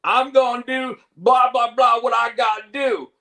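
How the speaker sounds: badly faked Southern accent, belted out. Host mocks an actor's attempt, bad Southern accent. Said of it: "try to do a Southern accent".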